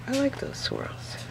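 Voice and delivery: unvoiced whisper on "like". Real, not acted. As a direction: breathe "I like those swirls" with no voice. Same words whispered after.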